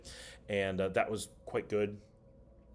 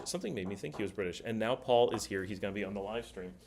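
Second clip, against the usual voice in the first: usual speaking voice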